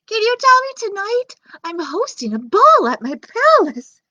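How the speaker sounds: high, loud princess voice; an eager princess inviting him; Cinderella's voice. Acted. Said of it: "Hey, Cindy".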